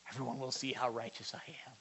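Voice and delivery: whispered self-righteous aside; hushed gloating mock confession; mock smug whisper. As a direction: whisper it smugly to yourself.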